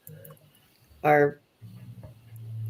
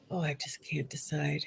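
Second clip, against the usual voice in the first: real whisper, half to herself while deciding; whispered aside; 5 semitones lower, 14 dB quieter than usual. Real, not acted. Host whispering her indecision to herself mid-stream. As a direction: breathe it out unvoiced, torn over what to pick. Torn between options, private aside.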